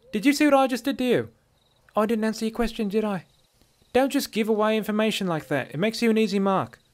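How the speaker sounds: high-pitched she-elf lecture; scolding dark elf woman. A narrator's reading. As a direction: tell off the naive stranger.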